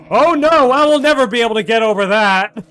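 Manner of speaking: loud excited wail. Real, not acted. Reacting loudly to a game scare.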